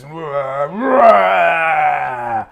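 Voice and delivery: loud mocking wah wah, like a big baby; mock crying. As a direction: sneer at them with a whiny fake wail. Mocking tough guys as crybabies.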